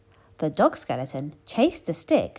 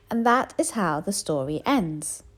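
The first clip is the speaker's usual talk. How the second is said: ordinary delivery, nothing marked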